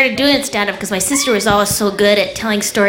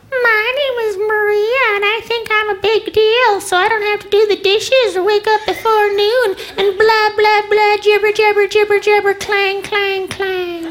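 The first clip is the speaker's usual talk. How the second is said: sister's mocking impersonation; 12 semitones higher than usual. Sister mocking comedian at the holidays, high droning impersonation. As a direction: drag it out high in a silly mocking sing-song. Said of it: "she came up with one of me".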